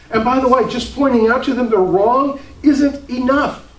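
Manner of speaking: high, loud, driving pulpit voice; full-force preaching. Acted. Preacher urging his church to stop arguing, fervent high preaching. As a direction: preach it hard, pressing the congregation.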